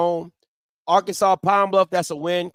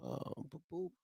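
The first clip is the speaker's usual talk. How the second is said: muttering to himself; 11 semitones lower, 14 dB quieter than usual. Real, not acted. Host checks schedule to himself.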